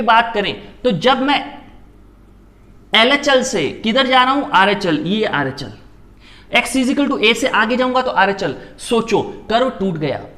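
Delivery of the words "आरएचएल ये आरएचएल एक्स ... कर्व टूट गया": ordinary delivery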